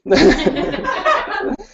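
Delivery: brief amused laugh at own joke; short laugh. Real, not acted. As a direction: laugh lightly at yourself after the quip. Joke about own accent training.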